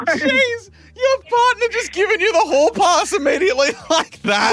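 high-pitched laughing disbelief. Real, not acted. Host reacting to caller's partner giving a hall pass, laughing. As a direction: crack up while talking, voice shooting up.

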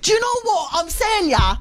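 high, hammed-up Irish accent; exaggerated Irish attempt, pitched right up. Shown as a demonstration. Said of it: "Irish accent".

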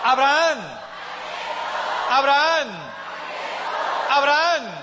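loud, high rallying call; llamado a viva voz. Acted. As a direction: call the name out across a crowd. Calls out to rouse the congregation. (llamado fuerte y agudo para despertar a la gente)

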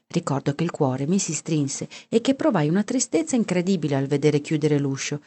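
usual speaking voice

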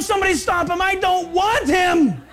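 preacher's comic God shouting to stop the man; mock shout. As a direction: yell like a God in mock alarm. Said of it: "He doesn't go".

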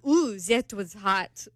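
slow, badly faked Italian accent; really bad accent. Woman quoting her Italian date, really bad accent. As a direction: stretch it out in a terrible mock Italian accent. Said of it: "that was a really bad accent".

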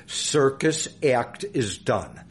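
"Circus act is done" slowly, word by word; deliberate repeat. Shown as a demonstration. Guest flags "Circus act is done" for a later question, slow and deliberate. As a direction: spell out "Circus act is done" at half speed so it sticks. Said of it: "remember that for a later question".

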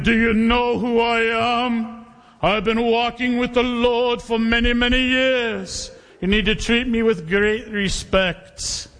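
slow, haughty Scottish brogue. Acted. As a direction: puff up like a pompous Scottish elder. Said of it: "So I'll go into my Scottish brogue".

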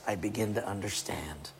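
a sudden secret whisper; hushed aside. Acted. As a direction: lean in and breathe the words. Preacher drops to intimate whisper.

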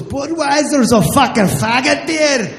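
loud, slurred Scottish bartender; high, barely intelligible drunken rant. Retold or imitated. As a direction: bellow thickly like a drunk Scot. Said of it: "The bartender is shit-faced".

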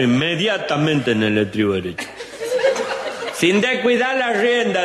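high, earnest voice reading riding instructions aloud; reading the lesson. Acted. Julián reads the riding book to the cousin, high and earnest. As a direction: read each step like a solemn instructor.

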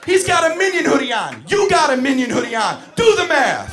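full-out high, loud yelling; furious teacher. Acted. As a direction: bellow the uncensored reply you can never give. Teacher's imagined uncensored yelling at child.

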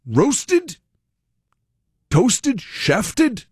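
high, questioning proper teacher, word by word; proper English teacher. Retold or imitated. Proper teacher reading a list to laughing students, high and questioning. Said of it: "like, proper dude".

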